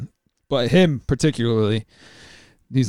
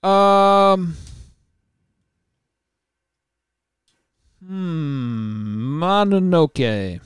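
long hesitant hums, then a slowly stretched answer; drawn-out deliberation; 5 semitones higher, much slower than usual. Real, not acted. Panelist weighing the best characters in a lightning round, drawn-out deliberation. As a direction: stretch every word while making up your mind. Deliberating over a quickfire choice.

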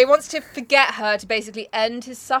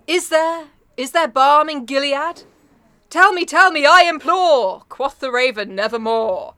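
louder, declaiming recitation, slow in places; dramatic poem reading; 3 semitones higher, 5 dB louder, slower than usual. Acted. Reading The Raven aloud to her co-host, in a declaiming voice. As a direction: give the verse full theatrical weight.

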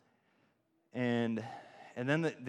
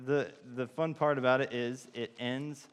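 ordinary delivery

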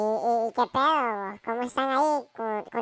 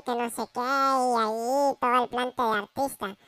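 ordinary delivery

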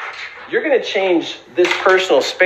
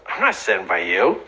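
curt brush-off; 7 semitones lower, 4 dB quieter than usual. Retold or imitated.

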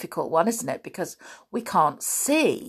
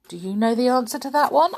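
ordinary talk, nothing marked

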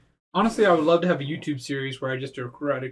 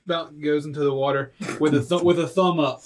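usual speaking voice

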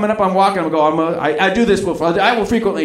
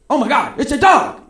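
high-pitched alarmed discovery; acted shock on spotting something; startled exclamation; 9 semitones higher than usual. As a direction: blurt it out high in surprise. Acts spotting a stray dog.

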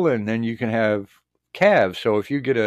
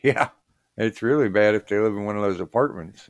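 ordinary talk

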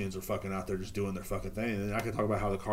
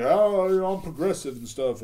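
high, louder preachy mock voice; 7 semitones higher, 5 dB louder than usual. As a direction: send up the smug progressive judge. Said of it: "he was just like".

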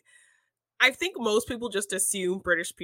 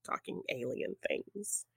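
whispering; 11 dB quieter, slower than usual. Acted. Spooky aside about aliens.

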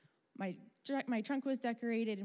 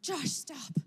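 sharp, higher, louder cry to stop; urgent call; 6 semitones higher, 5 dB louder than usual. Retold or imitated. Spotting the bottle, calling to Josh to stop, excited shout. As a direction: call out to halt the car.

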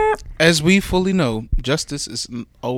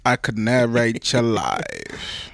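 low, soft, very slow narrator; Morgan Freeman impression; 6 semitones lower, 8 dB quieter, much slower than usual. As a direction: soothe them like a documentary narrator. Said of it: "That voice is the most soothing voice".

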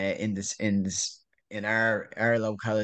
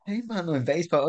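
higher-pitched mock tough-guy taunt; a cheeky swaggering challenge; cocky little baseball guy; 7 semitones higher than usual. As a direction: imitate the little fella strutting and shoving. Repeats the baseball guy's taunt.

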